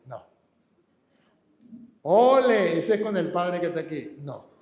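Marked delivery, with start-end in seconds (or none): 2.0-4.1 mock bullfighter. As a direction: play a bullfighter passing it on. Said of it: "dejo de ser torero".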